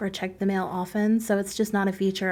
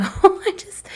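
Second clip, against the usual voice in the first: laughing; 10 semitones higher, 5 dB louder than usual. Real, not acted. Laughs at own shortcut.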